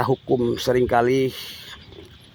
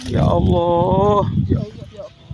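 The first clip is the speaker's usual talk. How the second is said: anguished lament; 3 semitones higher than usual. Real, not acted. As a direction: plead to God out of grief. Grieving father ends in lament.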